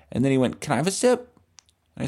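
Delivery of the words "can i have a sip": put-on pushy cool kid.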